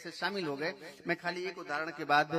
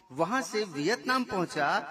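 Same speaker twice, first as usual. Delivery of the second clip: ordinary talk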